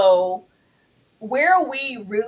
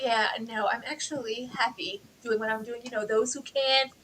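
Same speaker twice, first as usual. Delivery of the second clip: mocking impression; 3 semitones higher, 3 dB quieter than usual. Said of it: "when she's like".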